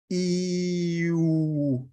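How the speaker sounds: a long ee sliding into rounded oo; ee gliding to oo. Shown as a demonstration. Teacher showing students the glide from ee to oo. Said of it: "move a very long E to an U".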